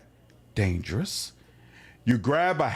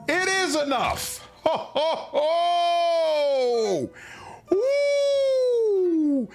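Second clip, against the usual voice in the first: triumphant shout; much slower than usual. Real, not acted. Player cheering the winning roll with a high shout. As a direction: cheer like you just won big.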